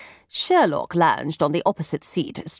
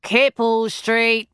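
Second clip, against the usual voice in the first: bored bawl; 4 semitones higher, slower than usual. A narrator's reading. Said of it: "bawled in a bored tone".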